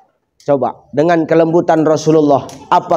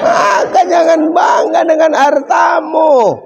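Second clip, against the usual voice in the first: preaching shout; 11 semitones higher, slower than usual. Real, not acted. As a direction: thunder it at the congregation. Preacher's climactic warning.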